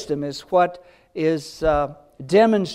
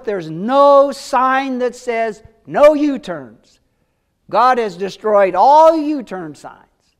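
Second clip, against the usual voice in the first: fervent; 4 semitones higher, 6 dB louder, slower than usual. Real, not acted. Excited point about repentance.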